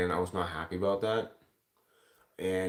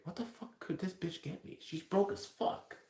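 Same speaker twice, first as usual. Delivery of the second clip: high, breathless whispered disbelief; incredulous whisper; 9 semitones higher, 8 dB quieter than usual. Retold or imitated. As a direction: whisper it like a scornful private thought. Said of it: "and I was like".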